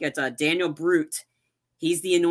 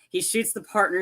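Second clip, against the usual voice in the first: normal talking voice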